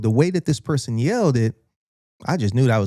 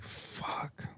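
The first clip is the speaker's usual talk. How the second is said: whispered curse; 21 dB quieter than usual. Retold or imitated. Cornered by the cop, muttering.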